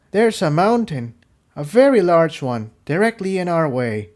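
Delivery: a blunt report of a mountain ahead; flatly. A narrator's reading. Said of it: "Tiptoe said flatly".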